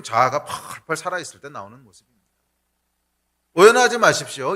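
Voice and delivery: usual speaking voice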